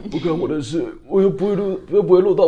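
mock the sulky newbie in the back row; 不耐烦的学员口吻; bored trainee voice.

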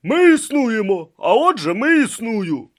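loud, high confident declaration; assured pronouncement. Acted. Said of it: "впевнено заявив він".

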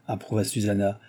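usual speaking voice

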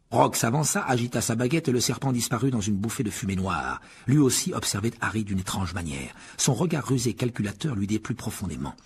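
usual speaking voice

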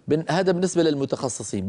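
usual speaking voice

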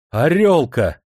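high, loud, cheerful call; merry shouting. A narrator's reading. New general ordering vodka for the messenger, merry shouting. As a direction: holler merrily for the servants. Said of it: "весело закричал он".

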